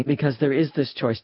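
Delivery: ordinary talk, nothing marked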